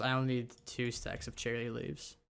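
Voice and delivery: ordinary talk, nothing marked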